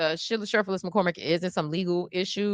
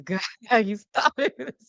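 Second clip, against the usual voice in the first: usual speaking voice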